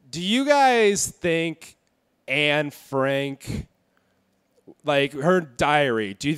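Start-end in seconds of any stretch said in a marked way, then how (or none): 0.1-3.7 slow tease. Acted. Slow teasing setup to audience.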